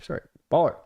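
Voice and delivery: ordinary delivery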